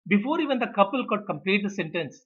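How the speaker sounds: normal talking voice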